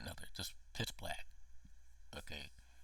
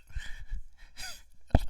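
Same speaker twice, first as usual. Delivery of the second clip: high strained laugh-cry; 12 dB louder than usual. Real, not acted. Overwhelmed while talking about ET.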